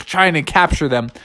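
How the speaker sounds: ordinary delivery, nothing marked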